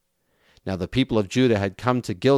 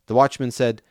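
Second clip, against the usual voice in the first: ordinary delivery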